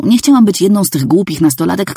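plain reading voice

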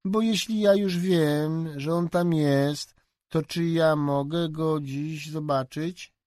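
put-on a high, slow, wheedling child's voice; a child coaxing an adult for a favour; coaxing little girl. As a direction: ask sweetly, drawing it out like a small girl. Child begging to see the dog.

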